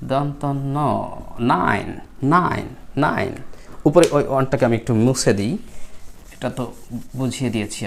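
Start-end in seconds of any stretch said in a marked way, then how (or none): none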